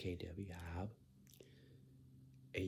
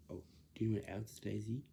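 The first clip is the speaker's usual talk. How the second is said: ordinary talk, nothing marked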